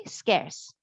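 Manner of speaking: "Scarce" said low, ending on a falling skairs; pronunciation model. Shown as a demonstration. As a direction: repeat "Scarce" with a clean S sound. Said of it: "So this word is pronounced as scarce".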